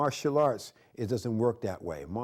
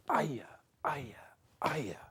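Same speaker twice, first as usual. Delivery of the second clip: breathy whispered karate shouts; an instructor marking strikes in a whisper; whispered kiai; 3 semitones higher, 5 dB quieter, slower than usual. Shown as a demonstration. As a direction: hiss the kiai under your breath. Whispered kiai during form.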